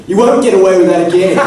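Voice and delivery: same threat in a plain Australian accent, loud. Shown as a demonstration. Actor showing how the line sounds in an Australian accent. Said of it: "when you say an Australian accent".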